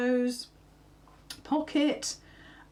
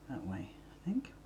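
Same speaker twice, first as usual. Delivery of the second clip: soft whisper, thinking aloud; whispered to self; 6 semitones lower, 12 dB quieter than usual. Real, not acted. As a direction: whisper it while deciding.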